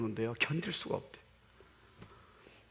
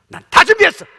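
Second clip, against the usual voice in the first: booming high cry of God's promise; voiced God's declaration; 16 dB louder than usual. Preacher voicing God to the congregation, booming cry. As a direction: proclaim it with full force at the top of the voice.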